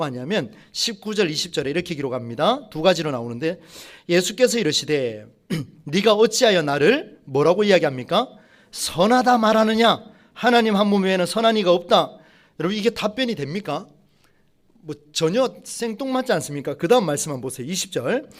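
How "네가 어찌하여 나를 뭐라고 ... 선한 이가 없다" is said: forceful scripture read. Acted. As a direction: declaim each word like a pulpit reading. Reading Jesus' words with force. (예수의 말씀을 힘차게 낭독)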